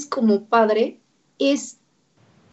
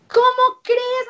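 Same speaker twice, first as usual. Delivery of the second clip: high, louder mock disbelief; incredulous parent; 12 semitones higher, 3 dB louder than usual. Parent brushing off the therapist, high mock disbelief. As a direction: toss it off as a parent who can't believe it. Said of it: "Me dicen".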